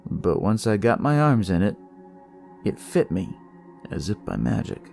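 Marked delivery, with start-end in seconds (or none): none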